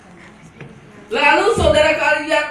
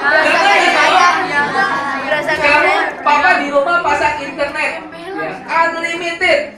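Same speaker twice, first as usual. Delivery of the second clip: doting parent; 3 semitones higher, 6 dB louder than usual. Retold or imitated. Parent voiced to child.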